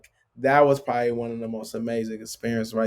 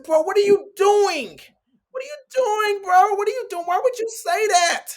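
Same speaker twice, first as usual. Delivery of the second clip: shocked protest. Retold or imitated. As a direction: demand what on earth he is doing. Shocked reply to the taunt.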